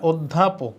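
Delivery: "অধ্যাপক" as od-dha-pok; a d, then dh, inside "অধ্যাপক"; correct doubled consonant. Shown as a demonstration. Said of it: "উত্তর হবে এটা".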